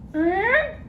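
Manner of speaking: very high squawky parrot speaking. Acted. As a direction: talk back as a cheeky parrot, very high. Parrot repeats syllable.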